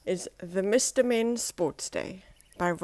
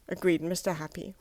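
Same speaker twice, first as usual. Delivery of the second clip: ordinary delivery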